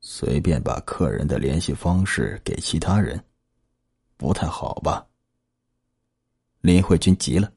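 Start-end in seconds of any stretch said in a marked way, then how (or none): 0.0-5.0 put-on low, much softer old tailor; slow, hesitant old man refusing. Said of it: "缓缓开口道".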